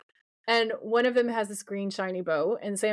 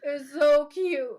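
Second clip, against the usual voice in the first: gushing; 6 semitones higher than usual. Real, not acted. Gushing to co-host over the childhood flashback, high and cooing.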